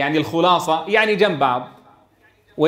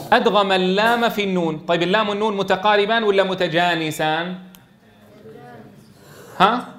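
usual speaking voice

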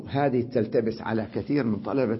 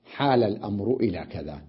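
normal talking voice

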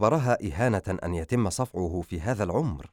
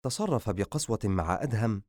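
plain reading voice

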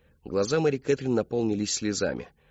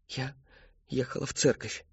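small, higher, hesitant answer; frightened; 4 semitones higher, 4 dB quieter than usual. A narrator's reading. Terrified daughter lying to her father, hesitant fear. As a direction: falter in fear before answering. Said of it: "Её охватил ужас".

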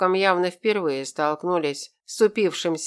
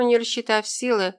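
usual speaking voice

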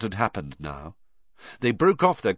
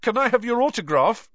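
high, louder blurted question; bursting out; 14 semitones higher, 5 dB louder, faster than usual. Acted. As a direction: blurt it out eagerly. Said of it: "Ron burst out".

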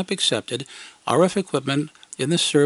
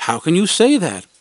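defensive; 4 semitones higher, 5 dB louder than usual. Retold or imitated. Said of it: "I stated in self-defense".

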